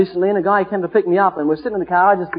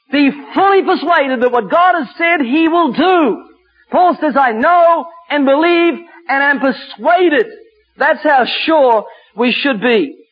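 raised, ringing declaration of faith; emphatic preaching; 4 semitones higher than usual. Acted. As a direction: build conviction phrase by phrase.

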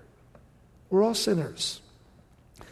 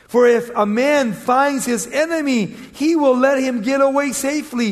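raised, emphatic Bible reading; scripture reading; 4 semitones higher, 6 dB louder than usual. Acted. Preacher reads scripture to congregation, emphatic and raised. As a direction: read the verse out to the congregation.

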